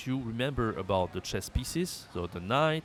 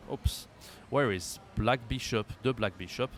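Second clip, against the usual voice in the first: ordinary delivery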